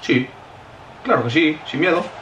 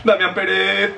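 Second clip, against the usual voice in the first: ordinary talk, nothing marked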